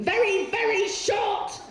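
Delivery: high, drawn-out emphatic squeal. Real, not acted. Comic describing a tiny star, high and emphatic. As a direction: stretch it upward for comic emphasis. Exaggerating how tiny he was.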